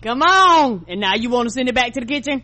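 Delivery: loud exasperated come on, then fast. Retold or imitated. Co-host scolding the customer sending food back, loud and fast. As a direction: throw up your hands at the customer.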